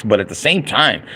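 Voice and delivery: usual speaking voice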